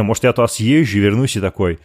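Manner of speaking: ordinary talk, nothing marked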